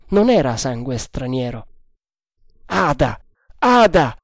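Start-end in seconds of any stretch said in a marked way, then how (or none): 2.7-4.2 name called out, then louder and higher; almost angry. A narrator's reading. Tremal Naik torn as he raises the axe, near-angry exclamation. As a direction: build to a sharp, near-angry cry. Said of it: "esclamò quasi con rabbia".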